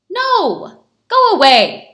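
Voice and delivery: loud, angry-style shout; shouted refusal. A narrator's reading. Tailor refusing at his door, loud shout. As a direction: shout them away from the door. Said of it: "shouted Mendel".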